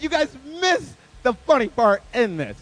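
ordinary delivery

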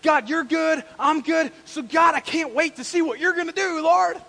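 loud, high eager praise; bursting with eagerness; fired up. Acted. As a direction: cheer God on at full voice. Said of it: "he's on fire".

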